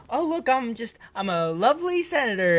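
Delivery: a hint of a forced public smile in the voice; fake-cheerful senator. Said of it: "like, fake smile".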